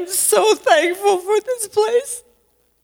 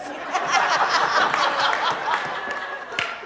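quiet laugh; 4 dB quieter than usual. Real, not acted. Embarrassed laugh mid-sentence.

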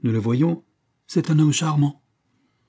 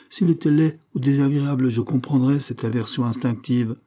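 ordinary talk

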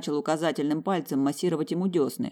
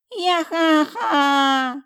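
put-on much higher, slow drawn-out baby wail; 13 semitones higher, 6 dB louder, much slower than usual. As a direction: wail like a screaming infant. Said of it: "заорал ребёнок в полную силу".

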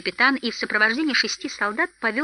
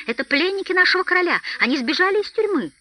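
high-pitched, urgent accusing cry; 10 semitones higher, 3 dB louder than usual. A narrator's reading. Elves denouncing escaped prisoners to the master, high cry. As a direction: raise the alarm, voice pitched way up. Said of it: "и закричали".

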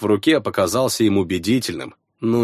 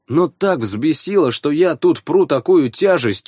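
ordinary delivery, nothing marked

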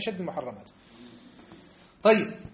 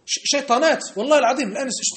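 raised higher and louder, heated protest; indignant outburst; 8 semitones higher, 7 dB louder than usual. Real, not acted. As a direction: burst out in outrage at the claim. Preacher bursts out indignantly. (انفعال غاضب بصوت مرتفع)

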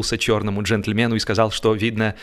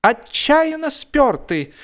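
high, slow disgusted voice; disgusted passenger; 3 dB louder, slower than usual. A narrator's reading. Passenger in a train compartment, disgusted at the stink, high and slow. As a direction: drawl it out like a sniffing passenger.

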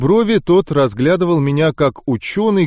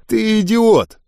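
higher-pitched scornful insult; disgusted; 7 semitones higher, slower than usual. A narrator's reading. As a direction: repeat the insult with a touch of disgust. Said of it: "скривившись, повторил". (брезгливо, высоким голосом)

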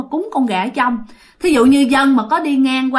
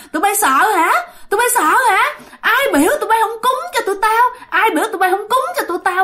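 high, fast, piercing taunt; taunting ghost; 9 semitones higher, faster than usual. Retold or imitated. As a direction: jeer at the scared soldiers like a spiteful ghost. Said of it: "mà chát chúa". (giọng ma chế giễu, cao và the thé)

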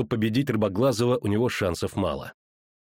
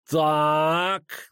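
menacing villain; 4 semitones higher than usual. A narrator's reading. Villain sizes up newcomers.

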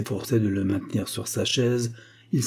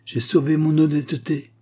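higher-pitched firm retort; 6 semitones higher, 4 dB louder than usual. A narrator's reading. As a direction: fire back in defence. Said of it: "rétorqua Agar".

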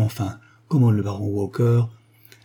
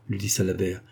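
usual speaking voice

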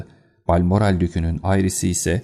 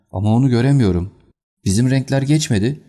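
fast, higher, louder worried cry; agitated; 5 semitones higher, 4 dB louder, faster than usual. Acted. Colonel scanning the course for his horse, agitated cry. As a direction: blurt it out in alarm.

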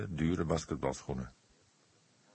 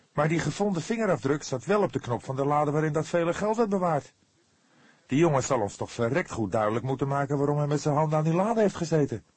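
higher, louder, insistent push; vehement; 9 semitones higher, 6 dB louder, faster than usual. A narrator's reading. Fledder insists to the cook about the fingerprint, vehement. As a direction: press the point hard, almost indignant. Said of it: "Fledder gebaarde heftig".

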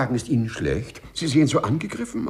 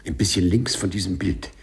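ordinary talk, nothing marked

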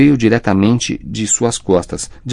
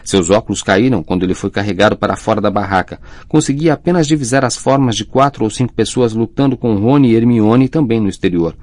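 ordinary delivery

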